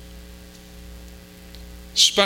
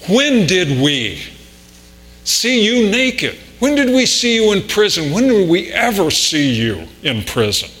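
bewildered asking; 6 semitones higher than usual. Acted. The blessed questioning Jesus.

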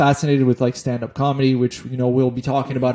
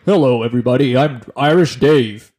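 radio voice; 5 dB louder than usual. Pretend. Host mocking the guest's on-air intro, radio voice. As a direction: put on a radio presenter's hello. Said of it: "I guess, a radio voice?".

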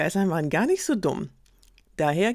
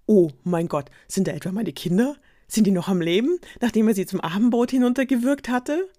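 louder, rising alarmed mother; shocked mother; 5 semitones higher, 6 dB louder than usual. Acted. Goat mother seeing her kids move in the belly, alarmed. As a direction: gasp it in disbelief.

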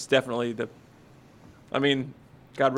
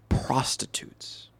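slow, scandalized whisper; dramatic whisper; 3 semitones lower, 9 dB quieter, much slower than usual. Acted. Reading the Bible story to the group in a scandalized whisper. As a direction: drop to a hushed whisper on the reveal. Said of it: "I'm gonna dramatize it".

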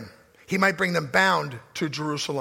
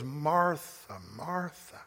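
much softer, tender, fading call; gentle chiding; 5 semitones lower, 10 dB quieter, much slower than usual. Retold or imitated. Preacher voicing Jesus speaking gently to Martha, tender. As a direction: sigh her name with loving patience. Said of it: "and he goes".

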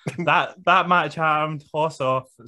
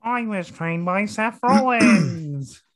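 higher and slow, proud keen rookie; eager wrestler impression; 8 semitones higher, 3 dB louder, slower than usual. Mocking Fraser bragging about his mentor, higher and slow. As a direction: say it like a starstruck rookie.

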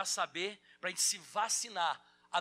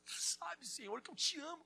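acted weeping Peter; 10 semitones higher, 3 dB quieter than usual. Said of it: "Aí ele começou a chorar".

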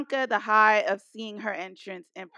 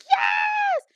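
a shrill, far higher yelp of joy, much louder; excited cheer; 3 dB louder than usual. Retold or imitated. Fan retelling Becky's music hitting, ecstatic high cheer. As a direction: burst out cheering like the crowd.

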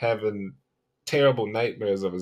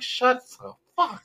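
mock outburst; 13 semitones higher than usual. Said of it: "everybody's just like".

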